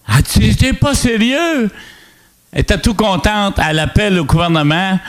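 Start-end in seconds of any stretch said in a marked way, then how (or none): 0.1-1.7 high, drawn-out incredulous question; delighted disbelief. Retold or imitated. Woman hearing he will take the house, delighted disbelief. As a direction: imitate her surprise, slow and pitched up.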